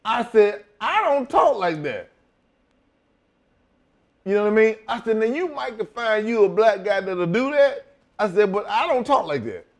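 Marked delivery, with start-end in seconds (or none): 0.8-2.1 high-pitched retold protest; object, offended by the request; mock-indignant, pitched high; indignant refusal. Acted refusal to promoter. 5.2-7.9 high-pitched retold pushback; acted-out protest, pitched high; indignant refusal. 8.5-9.7 high-pitched retold protest; insist, affronted; acted-out objection, pitched high; indignant refusal.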